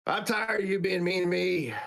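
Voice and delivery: high-pitched mock protest; fed-up girl. Girl standing up to her mean family, fed up.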